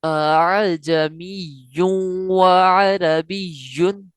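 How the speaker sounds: अअजमियुन व अरबियुन with the second hamza softened, slow chant; tasheel recitation. Shown as a demonstration. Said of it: "कैसे पढ़ना है?". (अअजमियुन व अरबियुन में नरमी से हमज़ा)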